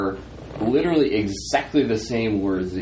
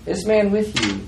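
high, emphatic put-on voice; Emily; 10 semitones higher than usual. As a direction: pitch it high for another character's voice. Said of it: "you hear Emily's voice say".

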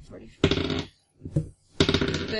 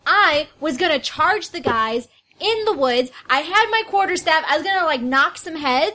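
defensive raised voice; 6 semitones higher, 6 dB louder than usual. Acted. Player defends herself in an argument.

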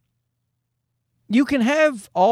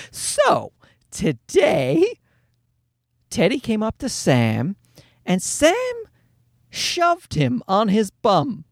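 a hint of a high, prim teacher voice; teacher breaking news gently, pitched up; lightly voiced teacher; 5 semitones higher, slower than usual. Teacher telling parents the news, lightly voiced high.

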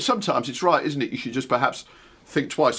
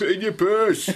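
put-on Yorkshire accent; 8 semitones higher than usual. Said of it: "good broad Yorkshire accent".

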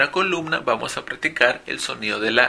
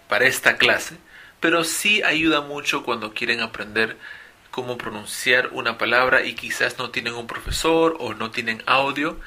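ordinary talk

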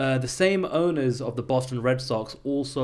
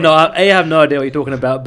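excited; 3 semitones higher, 11 dB louder, much faster than usual. Real, not acted. Protests amid the teasing.

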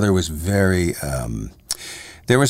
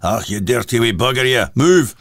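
gruff Glaswegian dad scolding, louder at the end; Glaswegian accent; 3 semitones higher, 4 dB louder than usual. Retold or imitated. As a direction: imitate a Glaswegian father ordering a child off. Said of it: "very big Glaswegian blue collar thing".

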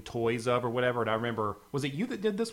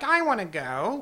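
put-on whiny kid; 7 semitones higher, slower than usual. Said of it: "we need Stanley to be like".

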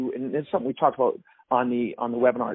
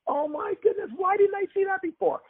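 sudden high-pitched realization, much higher; aha surprise. Retold or imitated. Tapping your forehead at a sudden realization, high surprised exclamation. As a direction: squeal it out like the light just went on.

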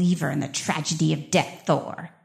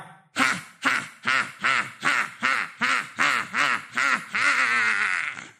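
high gloating cackle; evil villain laugh; 14 semitones higher, much slower than usual. Acted. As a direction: cackle in triumph, higher. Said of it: "good villain speech".

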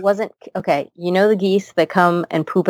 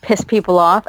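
usual speaking voice

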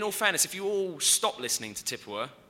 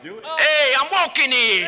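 put-on loud high New York American accent; brash New York American shout; 7 semitones higher, 11 dB louder, slower than usual. Showing off his American accent, loud New Yorker. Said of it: "[name]'s American accent".

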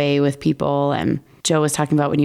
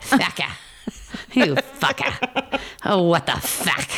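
slow, drawn-out Irish "You fucker, oh, what the fuck", the first part pitched up; mock Irish swearing; 2 semitones higher, 3 dB quieter, slower than usual. Friend hamming up Irish swearing, slow and drawn out. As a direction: stretch out the curse lazily in an Irish lilt. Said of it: "And they".

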